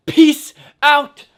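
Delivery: high, loud, bouncy farewell to viewers; hyped sign-off. Real, not acted. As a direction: sign off with big energy. Enthusiastic video sign-off.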